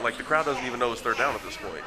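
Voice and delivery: usual speaking voice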